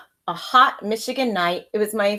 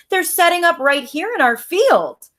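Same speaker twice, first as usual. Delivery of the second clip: put-on high, louder eager kid shouting; excited shout; 9 semitones higher, 5 dB louder than usual. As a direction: call out the news breathlessly eager. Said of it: "my brother shouted".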